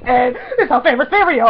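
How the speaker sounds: high, loud gushing; giddy run-on blurting; excited babbling. Retold or imitated. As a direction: babble it out as a starstruck fan. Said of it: "my sentences weren't making sense".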